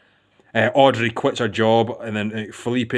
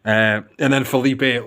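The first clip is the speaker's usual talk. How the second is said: ordinary delivery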